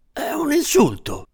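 a small man going pale at the insult; shocked little man. Acted. Little man recoiling from the assistant's accusation, shocked and high. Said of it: "disse il piccolo uomo impallidendo".

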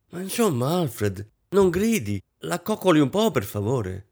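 high-pitched reproachful pleading; protest, pleading for gentleness; reproving plea. A narrator's reading. Guest urging husband to soothe wife, reproving.

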